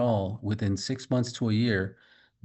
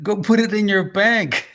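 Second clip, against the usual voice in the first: much higher and louder, an amused exclaim; excited exclamation; 10 semitones higher, 7 dB louder than usual. Retold or imitated. Telling the lady to bank the money, excitedly. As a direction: blurt it out with delight.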